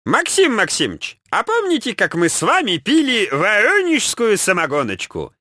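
высокий картавый голос Ленина; мягко и картаво, голосом вождя. A narrator's reading. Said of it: "мягко спросил Владимир Ильич картавым голосом". (картавый Ильич, высокий и мягкий)

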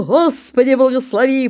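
громкий возглас; crying out a blessing. Acted. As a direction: shout the prayer before plunging. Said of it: "крикнул".

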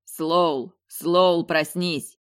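usual speaking voice

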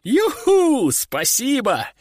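high-pitched gleeful whoop; overjoyed. A narrator's reading. Recruit welcomes his punishment, overjoyed whoop. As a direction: whoop with delight, then give thanks. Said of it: "обрадовался я".

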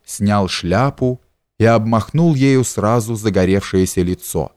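ordinary delivery

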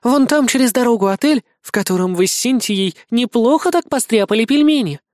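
high-pitched, wry assistant voice; high, knowing assistant; teasing female assistant. Acted. Помощница поддразнивает героя.

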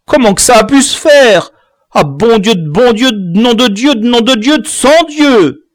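high, loud despairing oaths; boy swearing in loud despair; desperate cursing. Acted. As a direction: curse the heavens in despair. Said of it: "La malédiction désespérée de Camus".

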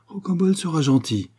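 usual speaking voice

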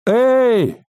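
high, loud call into the dark. A narrator's reading. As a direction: shout out to be heard. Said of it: "позвала Алиса".

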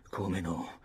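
plain reading voice

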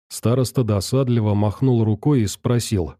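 usual speaking voice

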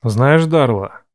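deep calm male voice; low, measured male tone. A narrator's reading. Ref's voice. (низкий мужской голос)

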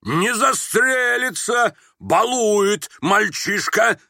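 high, very slow, pompous bellow; indignant, self-assured shout. A narrator's reading. General dismissing the boy's threat, shouting indignantly. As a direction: proclaim each word with outraged aplomb. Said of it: "с негодованием и с опломбом неожиданно прокричал".

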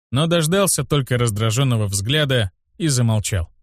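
usual speaking voice

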